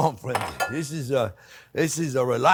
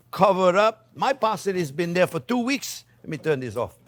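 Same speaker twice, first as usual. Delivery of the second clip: ordinary talk, nothing marked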